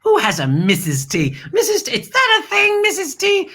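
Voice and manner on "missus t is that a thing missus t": high, incredulous mock squeal; mock disbelief. Host mocks the failed tea maker, high incredulous. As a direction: act baffled that this ever existed. Mocking a flop product.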